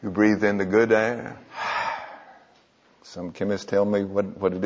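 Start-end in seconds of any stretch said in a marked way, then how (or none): none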